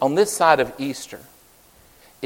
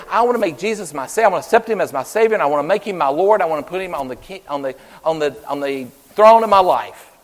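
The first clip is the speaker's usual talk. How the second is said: eager fast prayer; 3 semitones higher, 7 dB louder, faster than usual. Pretend. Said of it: "Say that".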